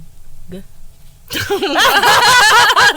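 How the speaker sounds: high, loud cackle of real laughter; helpless high laugh; loud burst of laughter. Real, not acted. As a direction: crack up out loud. Bursts out laughing at her face.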